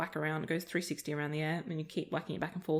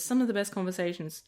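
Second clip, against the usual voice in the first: ordinary talk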